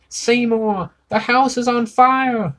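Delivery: put-on very high, loud old-lady cry; frail old mother yelling. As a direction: call down in panic like an old mother. Old mother calling from upstairs.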